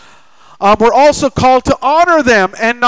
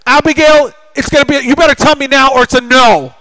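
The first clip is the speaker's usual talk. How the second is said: loud, higher, commanding dad; mock-stern father threatening a no; stern ultimatum; 5 semitones higher, 5 dB louder than usual. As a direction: lay down the ultimatum loudly.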